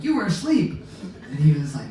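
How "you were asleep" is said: shocked accusation. Retold or imitated. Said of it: "and I'm like".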